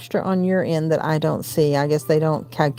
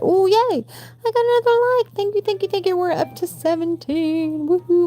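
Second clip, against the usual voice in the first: high, squealing thanks; excited over likes; 14 semitones higher than usual. Real, not acted. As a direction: celebrate each like much higher. Celebrating a new like.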